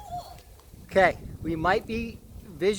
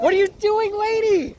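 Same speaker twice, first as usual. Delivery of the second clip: high, loud, playful yelp; excited yelp; 8 dB louder than usual. Real, not acted. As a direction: tease her with a shrill yell. Teasing friend filming swarm.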